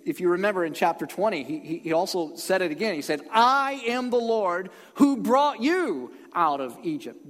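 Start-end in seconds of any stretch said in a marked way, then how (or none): 3.3-7.3 "I" high, loud and drawn out; weighty, raised "I am the Lord"; stress on I. Acted. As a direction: declare it slowly as God, leaning hard on "I". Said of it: "Do you see where the emphasis is?".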